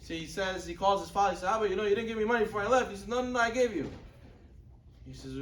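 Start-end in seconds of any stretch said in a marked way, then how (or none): 1.5-2.9 higher, louder, rushed protest; acted complaint. Son calling his father about missing money, hurried protest. 3.1-3.9 high-pitched, quick string of denials; insistent acted denial. Father answering son about the money, insistent denial.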